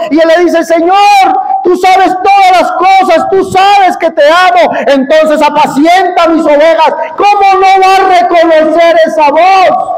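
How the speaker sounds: high, loud, fervent preaching; full-force preaching. Acted.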